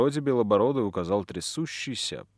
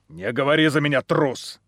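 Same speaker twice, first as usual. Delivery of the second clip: spat out; 3 semitones higher, 6 dB louder than usual. A narrator's reading. Brun rebukes the coward speaking for him, spitting it out. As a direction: spit the insult out with contempt. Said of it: "выплюнул Брун".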